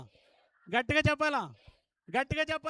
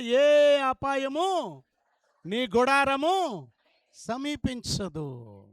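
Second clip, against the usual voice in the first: slow emphatic; 7 dB louder, much slower than usual. Acted. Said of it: "గట్టిగా చెప్పాలా?".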